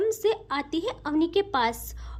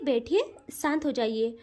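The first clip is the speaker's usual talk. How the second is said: soothing; 7 semitones lower than usual. Acted. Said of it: "कहती है".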